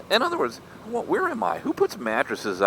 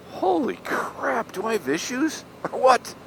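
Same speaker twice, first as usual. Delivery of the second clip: mock shock; 11 semitones higher than usual. Real, not acted. Mock alarm at own fussiness.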